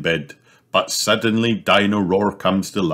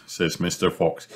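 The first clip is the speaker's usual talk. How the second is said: plain reading voice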